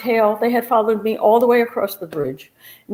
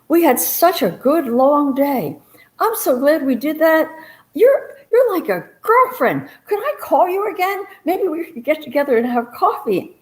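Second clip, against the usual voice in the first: gushing Doreen; 8 semitones higher than usual. Retold or imitated. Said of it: "Doreen says to me".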